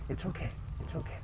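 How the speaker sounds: soft breathy it's okay, okay; whisper to calm the dog. Real, not acted. Whispering to the anxious dog yawns: soothing hush.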